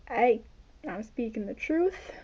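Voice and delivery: usual speaking voice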